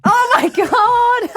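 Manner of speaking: loud, high drawn-out shriek; real squeal of amazed delight; delighted shock. Real, not acted. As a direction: shriek it out long at the punchline. Listener reacts to story's punchline.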